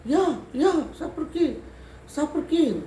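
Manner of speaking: high, pleading spirit voice. Retold or imitated. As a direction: give in like a spirit begging to go. Possessing spirit agrees to leave.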